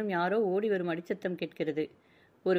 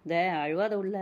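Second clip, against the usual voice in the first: plain reading voice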